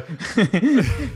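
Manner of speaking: loud high laugh; burst of laughter. Real, not acted. Laughing at the old man remark.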